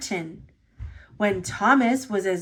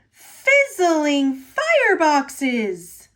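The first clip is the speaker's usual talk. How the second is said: slow, louder cry of wonder, little engine voice; amazed exclamation; 4 semitones higher, 5 dB louder, much slower than usual. Acted. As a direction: savour every word in astonishment. Thomas amazed at hidden station.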